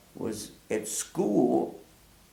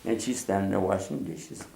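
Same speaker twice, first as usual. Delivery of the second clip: normal talking voice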